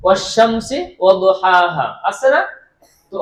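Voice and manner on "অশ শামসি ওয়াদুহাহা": অশ-শামসি ওয়াদুহাহা recited slowly; slow recitation. Shown as a demonstration. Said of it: "আর পড়া যাবে না".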